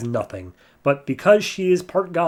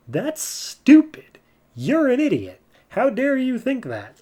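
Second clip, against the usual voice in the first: mock outrage; 11 semitones higher than usual. Soraka berating Wonder Woman for sparing Hera, high mock anger. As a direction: drag out the insult in disbelief.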